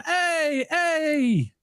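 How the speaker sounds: high, drawn-out calls for help; retold yelling. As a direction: imitate calling up from below, not full volume. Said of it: "And I'm yelling".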